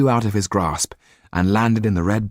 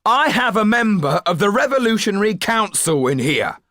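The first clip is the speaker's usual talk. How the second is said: high, desperate driver's call; shouting; 3 dB louder than usual. Acted. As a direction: shout it to fend him off.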